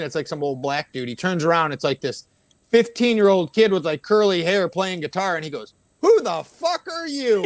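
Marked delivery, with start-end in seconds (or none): 6.0-7.5 high, drawn-out mock yell; retold outburst, pitched way up; loud drunken outburst. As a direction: imitate a drunk blurting it to the whole room. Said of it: "Like, loud, loud enough that the whole room heard it".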